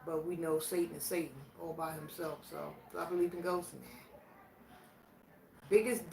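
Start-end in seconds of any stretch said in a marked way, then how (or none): none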